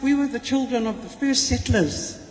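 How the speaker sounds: usual speaking voice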